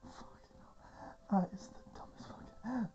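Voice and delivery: breathless, voiceless aside; whispered disbelief. Real, not acted. Reader reacts to a silly title, whispered disbelief. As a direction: whisper it, helpless at the silliness.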